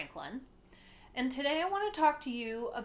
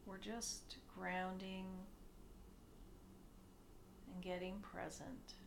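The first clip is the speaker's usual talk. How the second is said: soft meditation voice with long pauses, growing quieter; hushed, unhurried guiding, sinking softer as it goes; guided meditation; 3 semitones lower, 10 dB quieter than usual. Acted. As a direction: ease them gently into stillness.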